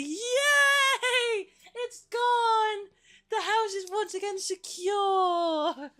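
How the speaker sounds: very high, loud laughing cheer; triumphant squeal, slowing at the end; mock-grand high cheer; victory cheer. Real, not acted. Celebrating fly gone.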